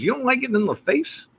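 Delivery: incredulous high-pitched mimicry; put-on high voice, questioning; imitating a friend. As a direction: ask it high, as the disbelieving pal. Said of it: "that's how he talked".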